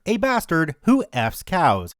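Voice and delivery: ordinary delivery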